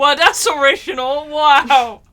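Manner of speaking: mock amazement. Real, not acted. Friend mocking player's spider name, loud mock amazement. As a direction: exclaim as if hugely impressed.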